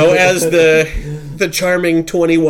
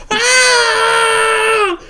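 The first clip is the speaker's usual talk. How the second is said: high shrill-sounding cry of shock, much higher; retold scream. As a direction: scream it like she just heard the worst news. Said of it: "she starts screaming".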